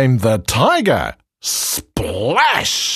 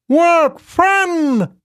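very high, eager elephant. Acted. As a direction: squeal it like a hopeful elephant. Elephant speaks in the story.

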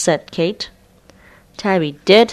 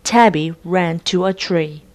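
ordinary delivery

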